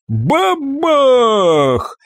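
high, long drawn-out bang word; explosive boom. A narrator's reading. Narrator breaks the countdown with a salvo, a high explosive cry. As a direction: let the blast ring out on the vowel. Narrator voices the second blast.

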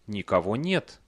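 ordinary delivery, nothing marked

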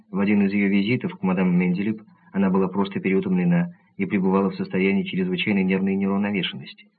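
plain reading voice

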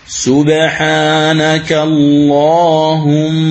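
اللهم slow with the final doubled meem held two beats; slow chant with a two-count ghunna; nasalized meem. Shown as a demonstration. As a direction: hum the meem at the end of اللهم. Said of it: "الغنة بمقدار حركتين".

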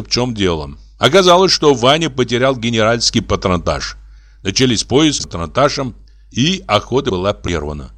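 ordinary delivery

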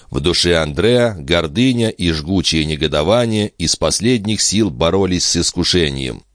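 plain reading voice

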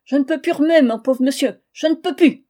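loud, high groaning lament; moaning. Acted. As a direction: whine it out loud like an old woman in pain. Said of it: "déclarant".

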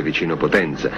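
ordinary talk, nothing marked

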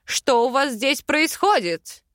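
high, angry barked question; furious bark. A narrator's reading. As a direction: snap it out in fury. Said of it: "рявкнул Аринель яростный".

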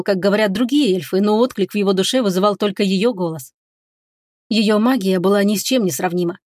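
plain reading voice

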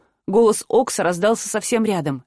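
ordinary delivery, nothing marked

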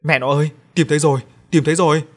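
shouting the good news to his wife; excited call. A narrator's reading. Husband calls to his wife after finding the tooth, excited. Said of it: "Chú kêu lên".

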